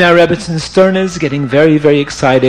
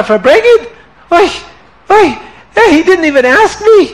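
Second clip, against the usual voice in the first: high, indignant outburst; upset wife; 8 semitones higher than usual. Acted. Rebbetzin fumes about Reb Shmuel Munkes, high indignant outburst. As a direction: sputter with wounded outrage. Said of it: "Rebbetzin Sterna got upset".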